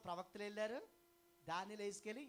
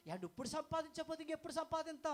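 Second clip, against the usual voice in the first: mock worried worker; 9 semitones higher than usual. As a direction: imitate a fretting job-seeker lightly. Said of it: "అన్నారు". (ఉద్యోగం గురించి ఆందోళనపడే వ్యక్తిని ఎగతాళిగా అనుకరించడం)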